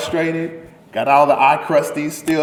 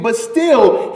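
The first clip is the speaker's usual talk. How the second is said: ordinary talk, nothing marked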